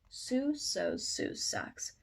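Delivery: usual speaking voice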